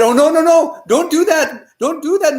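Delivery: high, urgent plea to stop; teacher frantically heading off a blunder; alarmed protest. Acted. Teacher alarmed at student's offer.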